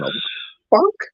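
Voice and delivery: imitating a viewer thrown by the date; baffled what the fuck. Friend mimics a confused viewer with a high, baffled cry. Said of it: "sitting there going".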